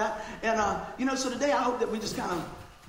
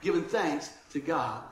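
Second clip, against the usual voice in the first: scripture reading; 6 semitones lower than usual. Acted. Reads scripture aloud.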